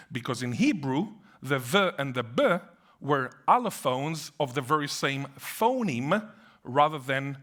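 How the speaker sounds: ordinary delivery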